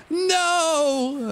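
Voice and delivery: long high wailed cry; hammed-up cry of despair; mock despair. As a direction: wail it way up high, drawn out. Mock despair at game luck.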